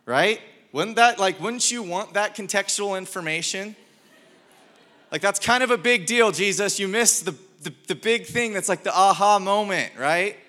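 loud, high-pitched preacher in full flow; worked-up preaching. Acted. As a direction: push it out to the whole room, incredulous.

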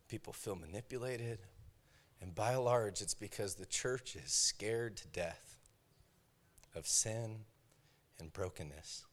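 soft, low, intimate sermon voice; gentle confessional preaching; hushed earnest preaching. Acted. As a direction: draw the congregation in quietly.

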